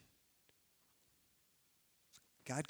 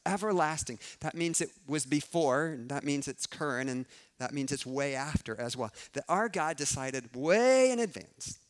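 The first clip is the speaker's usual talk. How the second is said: loud, pressing preacher's build; preaching at full force; 3 semitones higher, 7 dB louder than usual. Acted. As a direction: drive the conviction home to the room.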